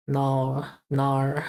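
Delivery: "no" with a plain long o glide; proper Australian "no". Shown as a demonstration. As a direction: say "no" ending on the letter O. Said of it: "how to say it properly".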